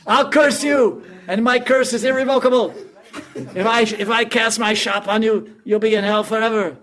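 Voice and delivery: high-pitched comic wailing yell; robbed man's shrill, loud tirade, acted out. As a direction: shout loud and high in hammed-up outrage. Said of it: "and he's yelling".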